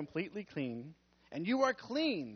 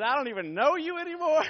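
1 mock dismay; 8 semitones higher, 8 dB louder than usual. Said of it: "[name] said".

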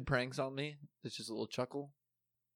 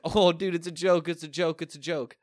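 high, loud, laughing it's-a-joke plea, fading down; mocking reassurance; 7 semitones higher, 9 dB louder than usual. Bosnian coworkers calling out to the angry narrator. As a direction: cheerfully insist it was only a joke. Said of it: "they're like".

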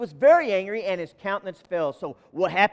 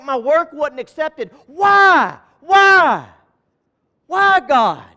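rising, much louder cries of why; anguished cry to God; 7 semitones higher, 10 dB louder, much slower than usual. Acted. Hurt worker crying out to God, loud and anguished. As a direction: plead up at heaven, louder each time. Voicing a frustrated believer.

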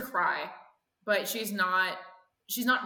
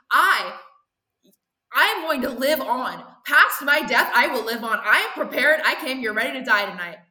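put-on rising, louder defiant teen rant; defiant daughter; 4 dB louder than usual. Daughter defies her mother at knifepoint, loud and proud.

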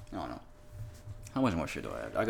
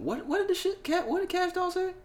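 pitched way up, straining to recall; high squeaky question. Real, not acted.

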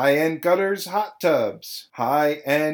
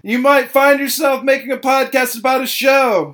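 much higher and louder, a frantic rant; loud song parody; 9 semitones higher, 11 dB louder than usual. Real, not acted. Host rants about his own podcast, loud and high.

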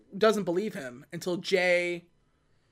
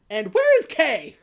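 mock yell; 3 semitones higher, 6 dB louder than usual. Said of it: "What are you yelling about?".

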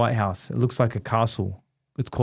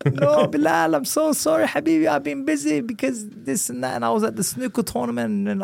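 much higher, [name] apologizing warmly; imitating [name]; 13 semitones higher than usual. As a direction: pitch way up to play the prince saying sorry.

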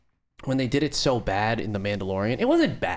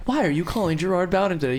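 robot screener; 5 semitones higher than usual. Retold or imitated. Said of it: "my own robot voice".

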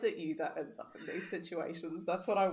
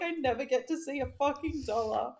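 higher-pitched mock-angry complaint; retold anger; 6 semitones higher than usual. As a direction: imitate an exasperated moan about money. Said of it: "he was getting so angry".

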